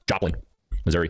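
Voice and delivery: normal talking voice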